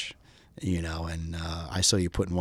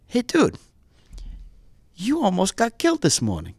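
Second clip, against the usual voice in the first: a hint of a Greek accent, pitched higher; 12 semitones higher, 4 dB louder than usual. Greek buddy warns his camping friend, light Greek accent. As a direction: lightly imitate a laid-back Greek buddy. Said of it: "my friend with the heavy Greek accent".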